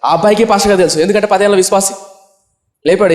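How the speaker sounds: usual speaking voice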